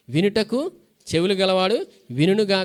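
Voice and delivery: ordinary talk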